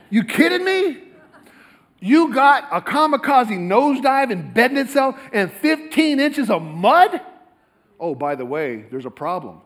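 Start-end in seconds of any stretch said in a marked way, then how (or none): 0.1-7.2 high, loud, slow disbelief; can you believe this nonsense; incredulous outburst. Acted. Speaker to audience, mocking the fossil story, loud incredulous disbelief.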